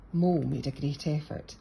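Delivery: ordinary delivery, nothing marked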